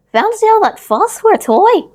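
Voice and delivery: high, loud, dismissive nursemaid; scoffing Nana. A narrator's reading. Nurse scoffing at the boy's toy, high and loud. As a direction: sniff at the fuss like a stern nurse. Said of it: "she said".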